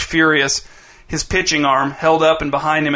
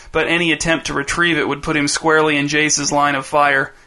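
plain reading voice

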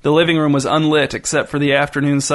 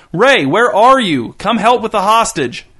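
high, loud, drawn-out call; 7 semitones higher, 5 dB louder, slower than usual. A narrator's reading. Gunman calls his partner to help with hostage, calling out. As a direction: holler for someone out of sight.

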